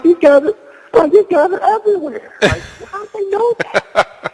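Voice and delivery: high, fast laughing disbelief; telling the host his amazement, high and laughing. Retold or imitated. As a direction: marvel at it through a laugh.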